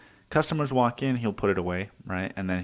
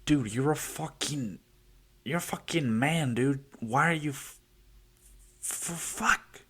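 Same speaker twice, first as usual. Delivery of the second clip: high, strained disbelief, sputtering over the words; exasperated outburst; 5 semitones higher, slower than usual. Retold or imitated. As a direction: blurt it out at a lazy coworker, choking on the swear. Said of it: "I do feel kinda like".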